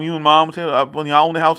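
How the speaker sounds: ordinary talk, nothing marked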